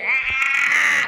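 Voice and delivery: loud mock screech of the bug; mock bug shriek. Retelling the cicada to John, loud mock shriek. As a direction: shout it like the bug's noise. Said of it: "it turned and went".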